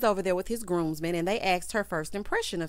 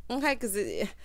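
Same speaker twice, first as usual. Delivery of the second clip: normal talking voice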